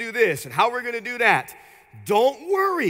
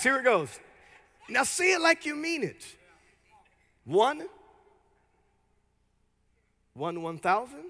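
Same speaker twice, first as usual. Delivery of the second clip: normal talking voice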